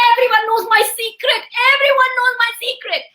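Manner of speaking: acted royal panic; panicking king. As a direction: fret aloud in a rising voice. King panics while pacing.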